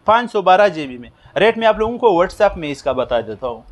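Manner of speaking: normal talking voice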